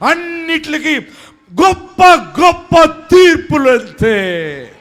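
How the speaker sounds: high, loud, slow shouted sermon; shouted preaching. Acted. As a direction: shout the blessing over the congregation. (సభకు గట్టిగా అరిచి చెప్పే ప్రసంగం)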